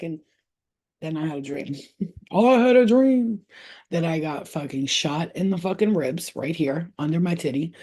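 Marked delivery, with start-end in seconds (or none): none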